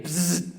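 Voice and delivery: imitated insect buzz; buzzing noise. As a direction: buzz like a bug in the wall. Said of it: "and I heard it again".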